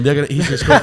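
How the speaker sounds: put-on retry the Fife accent and fail; attempted Fife accent. Mimicking wee Raith fans amid laughter, failed Fife accent. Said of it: "I can't do a Fifer accent".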